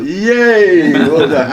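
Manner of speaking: high, loud delighted yay; excited cheer. Retold or imitated. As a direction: cheer with joy. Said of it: "and I thought".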